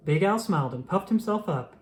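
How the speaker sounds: plain reading voice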